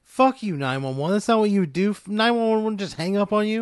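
high, loud angry protest; indignant outburst. Acted. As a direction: protest in outraged disbelief. Operator hung up on him.

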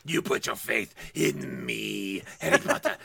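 low, fast Fierstein-style Voldemort. Acted. Host mocks Voldemort in Harvey Fierstein voice. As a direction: sneer like a campy dark lord. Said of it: "so it's going to be a Voldemort".

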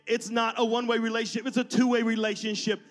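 earnest pastor in full flow; impassioned preaching. Real, not acted. Preaches to congregation at raised pitch.

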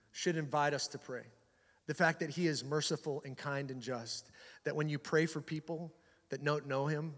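hushed, low, earnest appeal; soft preaching. Real, not acted. Preacher calling church to pray for people, hushed. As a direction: gently urge them to pray.

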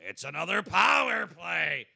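slow, booming arena announcer call; loud drawn-out arena announcement. Shown as a demonstration. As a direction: boom it out over the rink like a PA announcer. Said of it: "you do the, you know, the P A announcing".

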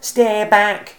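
shouted warning. Acted. Bruce warning Cyril away from the cone, loud high shout. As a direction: shout to keep him off. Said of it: "shouted Bruce".

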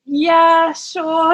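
high, hesitant agreement; a shy high yes; coy. Retold or imitated. Letting him see her blog, high and coy. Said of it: "I just remember being so coy".

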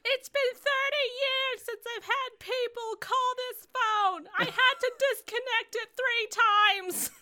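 high, loud exasperated lady voice; annoyed hotline lady. Acted. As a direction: shriek it as a fed-up operator. Playing annoyed hotline operator.

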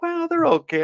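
mimicking the kid. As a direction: squeak it out like a picky kid. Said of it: "He's like".

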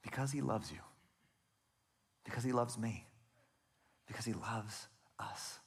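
hushed, soft answer with long pauses; soft, unhurried and sincere; tender assurance. Real, not acted. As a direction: let it drop to a gentle, intimate reassurance. Tender answer to his impassioned questions.

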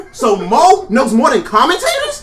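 loud, slow, laughing disbelief; incredulous challenge. Real, not acted. Challenging a friend's claim about commentators, incredulous. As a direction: throw it back as a drawn-out, high, loud question. Mocking challenge in heated argument.

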